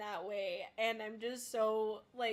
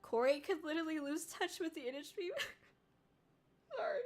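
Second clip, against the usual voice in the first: high, tearful break at the end; trying not to cry; 6 semitones higher than usual. Real, not acted. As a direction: voice rising and breaking into tears. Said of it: "I'm really trying not to cry".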